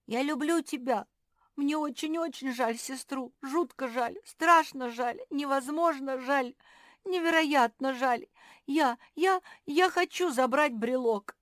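high, loud outpouring of pity; impassioned pleading. A narrator's reading. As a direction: plead urgently, stammering into the final wish. Pug pleads, pouring out pity.

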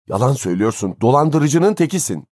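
rising to a high-pitched outburst; angry accusation. A narrator's reading. Father calls the old man an impostor, angry. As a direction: start firm, then flare up.

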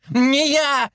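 very high frightened squeal. A narrator's reading. Watchman denying blame while attacked, squealing. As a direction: squeal the denial in panic. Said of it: "визжал сторож".

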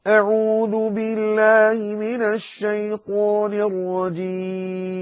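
slow, high melodic tajweed chant; slow high Quran chanting; Quranic recitation. Acted. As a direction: recite the verses with long held vowels.

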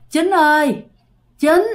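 lightly rasped, high calling voice; hoarse woman calling. Acted. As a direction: call out a name like a hoarse stranger at night. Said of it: "cái giọng của người này rất là khàn".

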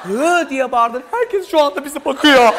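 loud, mortified telling-off; building to an indignant yell; acted outraged scolding. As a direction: shout at your partner for embarrassing you. Acted girlfriend scolding her embarrassing partner.

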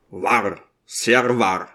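plain reading voice

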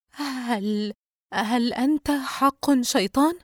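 high, slow, faltering question; shaken stammer. A narrator's reading. Yusra asks the creature if it is a devil, shaken. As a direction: stumble over the words in shock. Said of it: "وهي لا تزال مهزوزة من هول ما تراه".

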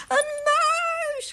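very high, drawn-out scream; shrieking. A narrator's reading. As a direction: scream at a mouse in panic. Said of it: "gilde mevrouw".

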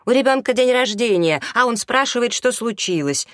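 loud, high-pitched scolding; angry bark. A narrator's reading. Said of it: "гаркнула на него Анжела".

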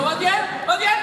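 loud teasing call of "vas bien"; mock shout. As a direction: bellow it to a sobbing recruit, half laughing. Mocking crying downline, shouting back. (grito burlón)

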